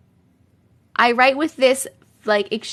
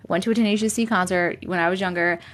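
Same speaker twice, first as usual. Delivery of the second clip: normal talking voice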